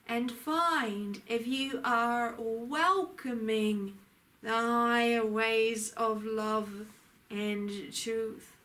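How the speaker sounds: usual speaking voice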